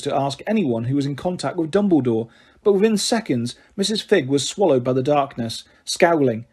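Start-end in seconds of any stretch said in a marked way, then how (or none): none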